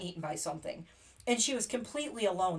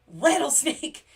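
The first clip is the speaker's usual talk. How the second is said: alarmed warning; 6 semitones higher, 8 dB louder, slower than usual. Retold or imitated. Joe warning of the snake.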